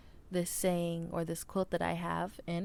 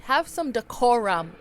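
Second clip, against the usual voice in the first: mock scolding; 4 semitones higher, 11 dB louder than usual. Scolding the planes overhead.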